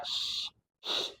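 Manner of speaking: SH as a voiceless hush, no vowel; isolated sh sound. Shown as a demonstration. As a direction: blow a soft, sustained SH. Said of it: "Jadi dibacanya".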